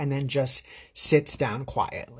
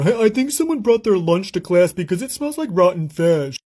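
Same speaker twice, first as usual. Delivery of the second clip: loud mocking boy's voice; imitated boy's yell; 3 semitones higher, 6 dB louder than usual. Boy yelling up to the teacher in class, mocking yell. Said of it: "he yells up to the teacher".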